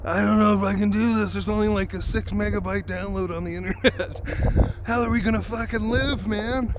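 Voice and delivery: put-on whiny city boy. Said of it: "And I'm like".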